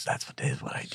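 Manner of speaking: whispering. Real, not acted. Whispered admission.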